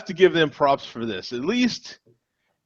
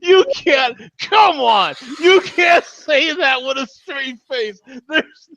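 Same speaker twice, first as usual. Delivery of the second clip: laughing; 12 semitones higher, slower than usual. Real, not acted. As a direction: crack up while protesting. Cracking up at innuendo.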